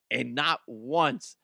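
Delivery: ordinary talk, nothing marked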